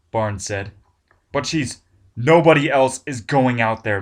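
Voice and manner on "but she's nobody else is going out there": loud command. Acted. Barnes cuts Ted off.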